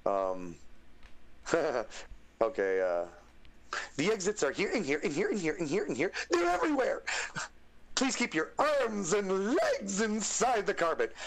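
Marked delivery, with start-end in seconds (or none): 4.0-7.0 manic, high-pitched flight attendant genie; high, swooping cartoon showman; Genie impression. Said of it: "like, a good genie scene". 7.9-11.1 high, drawn-out zany showman; big slow cartoon genie announcement; Genie impression. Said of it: "like, a good genie scene".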